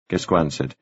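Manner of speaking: ordinary delivery, nothing marked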